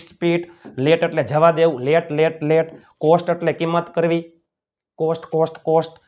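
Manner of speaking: usual speaking voice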